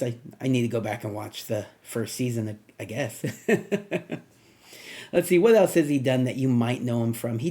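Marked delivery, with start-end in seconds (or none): 3.3-4.2 higher-pitched chuckle to oneself; short run of self-amused laughter; light laugh. Real, not acted. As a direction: chuckle after an admission. Laughs at his own admission.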